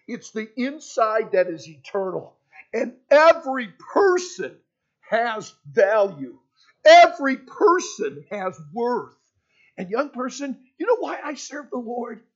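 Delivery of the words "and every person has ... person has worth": loud, slow, each word pressed; preaching emphasis. Acted. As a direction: hammer every and worth.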